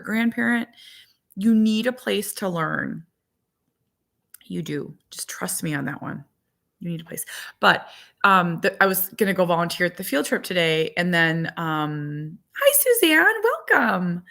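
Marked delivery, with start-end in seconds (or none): 4.4-7.2 1 hushed aside. Real, not acted. 12.6-14.2 1 high greeting. Real, not acted. Greeting a live viewer.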